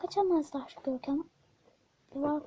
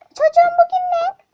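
furious mother; 13 semitones higher, 16 dB louder than usual. Acted. Mother scolds son.

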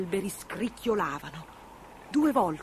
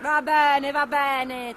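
high, loud impatient witch; raised witchy voice, dismissive; cutting short; 6 semitones higher, 6 dB louder, much slower than usual. A narrator's reading. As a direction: brush the cat off impatiently. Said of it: "tagliò corto la strega".

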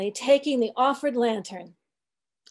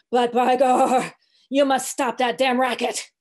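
put-on louder, grumbling sermon from the old cook; old cook preaching; 4 dB louder than usual. Old cook sullenly preaching to the sharks, grumbling and loud. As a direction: preach to the sharks grudgingly, dialect and all. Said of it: "in a mumbling voice".

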